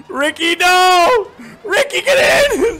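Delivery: high, loud desperate yell; shouting. Real, not acted. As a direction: yell at him to get in. Yelling at teammate in game.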